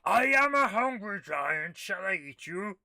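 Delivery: slow, loud mock giant threatening the player. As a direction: boom it out like a hungry giant. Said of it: "A giant voice says".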